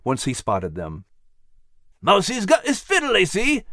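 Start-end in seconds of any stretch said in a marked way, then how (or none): 2.0-3.6 Max voiced. A narrator's reading. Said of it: "observed Max with a big grin".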